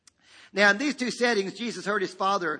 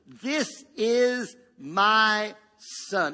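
very slow, loud proclamation; booming word-by-word declaration from heaven; voice of the Father; 3 semitones higher, 5 dB louder, much slower than usual. Acted. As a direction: space out every word and hold it. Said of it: "along with a voice says".

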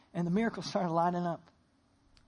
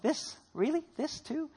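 higher-pitched, incredulous questions; mild disbelief; 5 semitones higher, slower than usual. Acted. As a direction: act out weary disbelief in short questions. Paul's reaction to snakebite, acted.

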